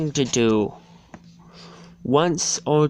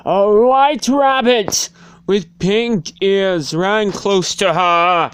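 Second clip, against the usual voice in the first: excited narration; 8 semitones higher, 8 dB louder than usual. A narrator's reading. Excited narration of rabbit's arrival.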